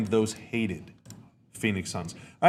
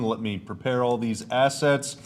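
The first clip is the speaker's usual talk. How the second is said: ordinary delivery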